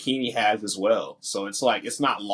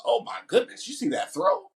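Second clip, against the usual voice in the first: imitating a stunned announcer, pitched higher; higher-pitched mock amazement; amazed announcer; 8 semitones higher than usual. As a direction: mimic the broadcaster's astonished reaction. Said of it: "the announcer stops and goes".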